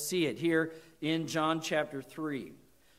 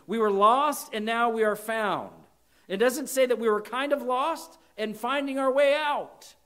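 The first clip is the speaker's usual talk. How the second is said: higher and louder, ringing out; preaching at full force; 6 semitones higher, 6 dB louder than usual. Real, not acted. Preacher stirring the congregation, loud and high. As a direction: proclaim it to the back pew.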